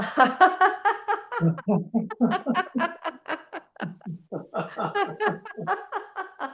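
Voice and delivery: long high peal after held breath; released laughter. Shown as a demonstration. Teacher releases held breath with class, long high laugh. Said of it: "breathe it out with laughter".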